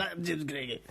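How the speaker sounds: exaggerated mock-accent mimicry of co-host; badly mangled teasing impression; James Craigie. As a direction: parrot your friend in a silly put-on accent. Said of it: "it sounds so much like you".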